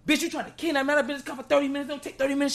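a hint of high-pitched panic; mock paranoid outrage. Cursing the Uber lady out, mock high accusation. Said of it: "I'm like".

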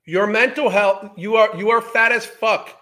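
loud, heated outburst; angry yelling. Real, not acted. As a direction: shout it at her in disgust. Ranting at the video subject.

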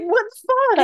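very high, laughing disbelief; mock alarm. [name] seeing a grenade tossed at him, high mock alarm. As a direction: squeal it as a hammed-up shock.

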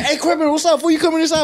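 high, loud mock holler; calling out. Fans passing the truck to Criminal, calling out. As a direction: imitate fans yelling for a rapper.

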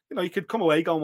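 normal talking voice

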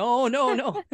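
high, playful string of protests; mock refusal. Host playing the wife refusing, mock protest. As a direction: refuse like a squabbling spouse, hammed-up. End of the acted argument.

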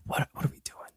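hushed, breathless whisper of a confused passenger; whispered quote. Retold or imitated. Passenger on the Titanic, whispered and bewildered. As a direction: whisper it like someone lost on the sinking ship. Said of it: "Titanic's like".

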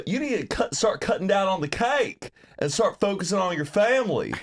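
Doctor Phil. Acted. Repeating the impression on request, twangy TV doctor. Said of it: "say it, do it again".

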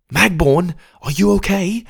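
high, anxious question; worried. Acted. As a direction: call out in alarm. Worried call to sick friend.

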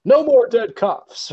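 high, hammed-up line reading; overdramatic extra. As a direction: deliver it like a bad extra. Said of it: "a bad line reading from an extra".

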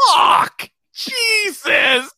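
mock shocked yell; hammed-up pained yelp; retold cry. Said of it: "they go".

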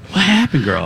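laughing impression of a clueless friend; hammed-up nosy question through laughter; Lex. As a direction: play the friend who missed everything. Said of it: "This is Lex".

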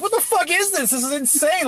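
high-pitched mock disbelief; appalled doctor. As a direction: exclaim like a doctor seeing something insane. Doctor reacting to the birth.

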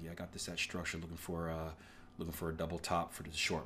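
softly voiced trading partner; hushed partner describing his short setup; quiet friend's reply. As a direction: lay out the plan in a hushed tone.

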